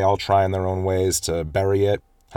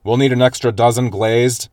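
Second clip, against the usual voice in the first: higher, louder order into a speaker; calling out; 4 semitones higher, 5 dB louder than usual. Retold or imitated. Mother ordering into the drive-thru speaker, called out. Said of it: "she calls out into the speaker".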